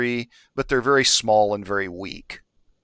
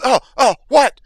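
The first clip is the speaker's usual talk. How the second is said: high, loud startled yelps; startled jump; 13 semitones higher, 9 dB louder than usual. Acted. Kobold woken with a poke, startled. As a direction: cry out as if poked awake. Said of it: "he kind of jumps".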